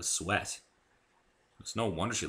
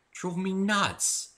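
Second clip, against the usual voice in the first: exasperated; 12 semitones higher, much slower than usual. Acted. Narrator's exasperated aside.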